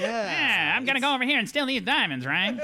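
put-on high, old-timey gangster talk; fast-talking 1930s movie gangster; James Cagney accent. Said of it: "gave him a James Cagney old-timey accent".

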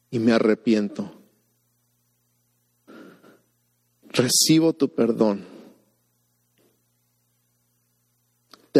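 slow repeat-after-me prayer, long pauses. Shown as a demonstration. Pastor leading viewers in prayer, slowly with pauses. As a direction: lay each phrase down slowly for others to repeat. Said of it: "repetir más lento para que".

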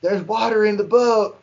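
high-pitched mock alarm; imitated shrieking. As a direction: imitate boaters crying out in a panic. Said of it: "a couple of them start shrieking".

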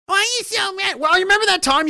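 high, mocking whiny question; mocking critic. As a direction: mimic the critics scornfully. Mocking what critics ask.

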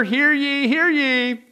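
loud, high crier's call; town crier. Retold or imitated. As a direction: announce it to the whole square. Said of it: "these guys went around and says".

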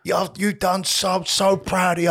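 put-on high, gushing drunk-mate voice. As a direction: lay it on thick like a drunk friend hugging you. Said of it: "They put their fucking arm around you".

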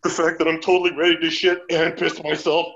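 deadly earnest retold admission; dead serious. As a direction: say it with total mock gravity. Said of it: "totally dead serious".

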